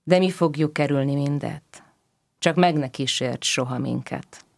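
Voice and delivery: usual speaking voice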